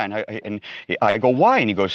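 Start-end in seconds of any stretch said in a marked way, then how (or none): 1.3-1.6 high, loud, baffled "Why?"; retold astonishment. Bhakta puzzled that God is bound, astonished. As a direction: blurt it out in disbelief. Retelling a puzzled question to Radhanath.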